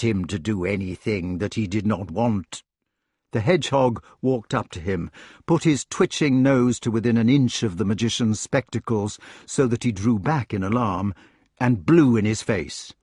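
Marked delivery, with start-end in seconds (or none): none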